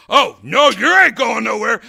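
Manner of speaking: hammed-up battle cry; defiant shout. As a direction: yell it as you leap after her. Chasing the bird woman.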